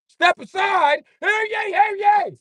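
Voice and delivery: very high, loud mock herald; hammed-up crier clearing the deck; town crier shout.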